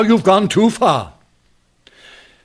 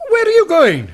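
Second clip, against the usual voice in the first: calling after him; 9 semitones higher than usual. Acted. Calling after departing inspector.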